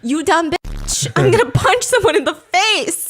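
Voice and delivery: slow, rising, loud mock rage; high, hammed-up angry threat; exasperated outburst. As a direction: act fed up to breaking point. Acted outburst at a contrarian.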